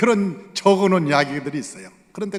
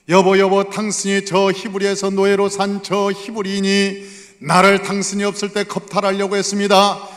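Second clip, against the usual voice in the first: false accusation; 4 semitones higher, 7 dB louder than usual. Retold or imitated. Wife accuses Joseph to husband.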